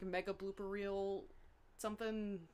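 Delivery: ordinary talk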